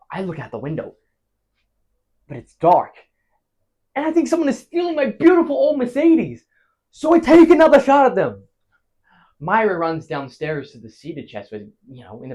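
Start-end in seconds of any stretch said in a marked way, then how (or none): 4.0-8.4 high, loud outraged climax; alarmed storytelling. Acted. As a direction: build to a shouted account of shooting. Reliving second shooting in alarm.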